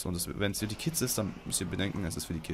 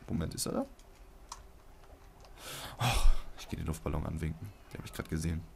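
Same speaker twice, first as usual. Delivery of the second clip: ordinary talk, nothing marked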